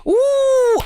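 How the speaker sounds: loud, high-pitched "woo"; long, piercing cheer; excited whoop. Real, not acted. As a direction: whoop it up in celebration. Celebrating nearing a thousand subscribers.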